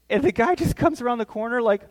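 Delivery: normal talking voice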